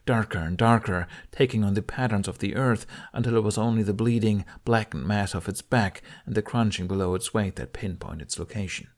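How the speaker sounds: ordinary delivery, nothing marked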